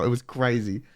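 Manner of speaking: ordinary delivery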